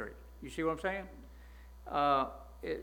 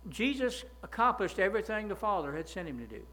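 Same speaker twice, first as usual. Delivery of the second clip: normal talking voice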